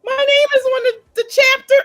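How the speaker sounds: squealing high and loud; thrilled, voice jumping way up; excited author. Retold or imitated. New author seeing her name in the chapter, squealing high. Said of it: "You're like".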